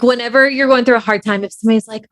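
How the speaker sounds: normal talking voice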